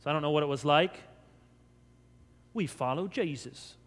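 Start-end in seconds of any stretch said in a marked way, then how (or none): 2.5-3.8 softly put-on Galilean accent; mock up a Galilean accent, softer; a quiet try at a Galilean accent. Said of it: "apparently there's some accent".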